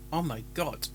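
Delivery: ordinary delivery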